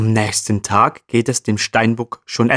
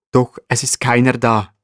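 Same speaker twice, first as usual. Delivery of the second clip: ordinary delivery, nothing marked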